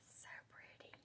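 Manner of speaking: barely audible whisper. Real, not acted. Crafter admiring prints with husband next door, whispering. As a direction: breathe it out in a tiny admiring whisper. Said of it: "I don't know why I'm whispering".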